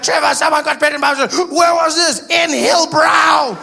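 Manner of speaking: high, loud panicked exchange; hammed-up alarm over terrible news; acted shock. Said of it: "You'd shock me if you came".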